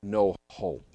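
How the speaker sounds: normal talking voice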